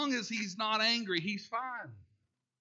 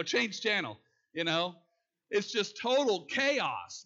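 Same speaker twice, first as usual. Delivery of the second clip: ordinary talk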